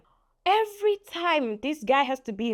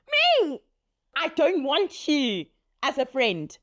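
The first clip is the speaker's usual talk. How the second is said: ordinary talk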